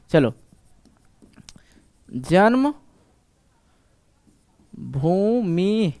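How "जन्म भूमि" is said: जन्म and Bhumi whispered apart with a long pause; whispered split reading; whispered syllables. Shown as a demonstration. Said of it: "इस तरह पढ़ना".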